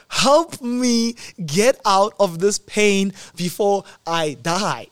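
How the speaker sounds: loud, slow, drawn-out begging for help; desperate plea. Retold or imitated. Patient in ICU begging the nurse for help, loud desperate plea. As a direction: plead like the pain is unbearable.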